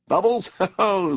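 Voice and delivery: high, merry exclamation with a ho-ho; cheery. A narrator's reading. Rat amused by mole's bubbles, cheery. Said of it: "chirped cheerily in an inviting sort of way".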